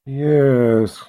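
drawn-out, drawled reply. A narrator's reading. Sister answering her colleague over the books, in a drawl. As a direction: stretch the word out lazily. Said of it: "Sister Amina said in a drawl".

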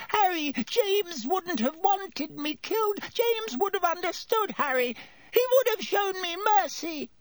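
high, squeaky desperate plea; begging for mercy. A narrator's reading. Pettigrew begs Harry for mercy.

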